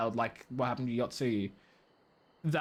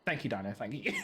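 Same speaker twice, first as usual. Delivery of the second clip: ordinary delivery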